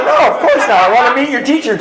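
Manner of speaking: high, loud, laughing through the words; laughing refusal. Retold or imitated. Dad laughing off his daughter's request, high and loud.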